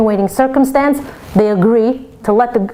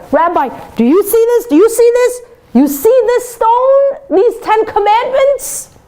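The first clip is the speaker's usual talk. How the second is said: indignant general; 12 semitones higher than usual. Acted. Angry general shows stone to rabbi.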